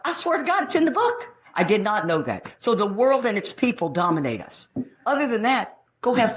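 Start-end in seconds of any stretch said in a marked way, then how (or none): 0.0-1.3 high, emphatic swearing it's true; insistent disbelief. Retold or imitated. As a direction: insist it's really written there. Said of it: "I thought".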